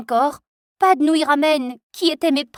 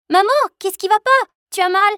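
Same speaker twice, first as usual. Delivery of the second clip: much higher, loud worried shout; alarmed call; 10 semitones higher, 7 dB louder than usual. Acted. Hears mother screaming.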